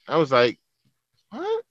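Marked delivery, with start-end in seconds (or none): 1.3-1.6 high, soft, rising disbelief; baffled retold question. Man retelling his reply to the woman at the gym, baffled question. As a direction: imitate a puzzled little squeak of a question.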